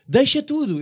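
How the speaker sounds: high, loud drill command; shouted order. Retold or imitated. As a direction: bark it like a fire-drill teacher. Voices the school fire-drill order. (ordem gritada)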